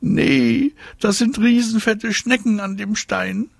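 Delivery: put-on very high squeaky disgusted girl; high-pitched grossed-out squeal. A narrator's reading. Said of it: "quietscht sie".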